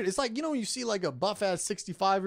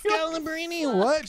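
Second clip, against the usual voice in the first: high, incredulous echo of the screamed name; mock scream; 12 semitones higher, 5 dB louder than usual. Comedian echoes the bedroom outburst as a startled partner, high mock scream. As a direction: echo the cry high, then a louder startled question. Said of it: "she just screams out".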